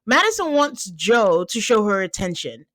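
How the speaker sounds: ordinary talk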